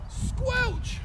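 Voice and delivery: squelch squeaked out high; playful high squelch for the kids; high squeaky squelch. Pretend. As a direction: pop the word up high and squeaky. Teacher squelches through pretend mud.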